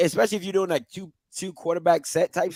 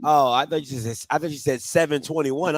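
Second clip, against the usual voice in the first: ordinary talk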